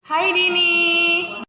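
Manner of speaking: slow, high call across a distance; high drawn-out hello call. Acted. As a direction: stretch the hi to reach a friend far off. Calling out to friend.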